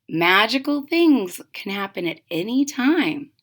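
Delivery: put-on lively read-aloud for kids; storybook narration. A narrator's reading. Reading a witch story to children, lively narration. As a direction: tell it like a bedtime picture book.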